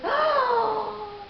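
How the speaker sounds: hammed-up astonished oh; mock amazement. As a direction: imitate someone gasping in wonder. Mimics the guy's gasped reaction.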